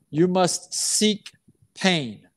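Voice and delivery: slow, each word weighed: "You must seek" then a drop onto "pain"; slow deliberate "You must seek pain" with a pause before "pain"; stress on pain. Shown as a demonstration. Coach urging players, slow and weighty. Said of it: "coach stresses seeking pain".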